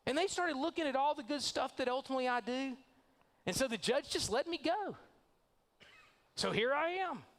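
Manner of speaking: high, casual self-satisfied brag; freed killer bragging to the church folks, smug and high; smug breezy boast. Acted. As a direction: shrug off the murder like it's nothing. Freed killer brags about release.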